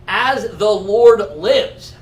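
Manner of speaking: very slow, weighty proclamation; mock-solemn, drawn-out declaration; grand oath. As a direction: draw out each word like a solemn royal oath. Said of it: "Saul could have just said".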